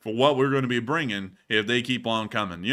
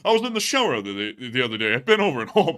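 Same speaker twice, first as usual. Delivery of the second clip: Rodney voice; 4 dB louder than usual. Acted. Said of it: "that Rodney voice".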